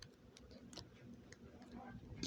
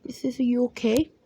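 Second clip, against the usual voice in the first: ordinary talk, nothing marked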